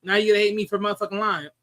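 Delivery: ordinary talk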